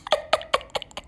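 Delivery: wheezy laughter; breathy, helpless laughing fit. Real, not acted. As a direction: crack up at your own joke. Laughs at shawarma joke.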